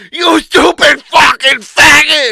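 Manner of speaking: slow, high, screamed insult; furious shout. Retold or imitated. Coworker screaming insults at him, furious shout. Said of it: "and then he goes".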